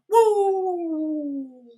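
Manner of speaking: high whooping eagle call; a hammed-up high whoop; eagle noise. Imitating the eagle cry he plans to film. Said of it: "make the noise myself ... going".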